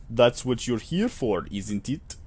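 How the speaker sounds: slow, put-on Russian accent; faux Russian accent. Clerk sending visitor to work, slow faux Russian accent. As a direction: instruct slowly in a fake Russian accent. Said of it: "a, faux Russian accent".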